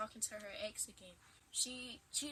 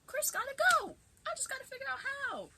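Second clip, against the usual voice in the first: much higher and louder, nearly yelling; exasperated outburst; 12 dB louder than usual. Retold or imitated. Fed up with girlfriend Chris, blurting exasperated outburst. Exasperated resolve, near shout.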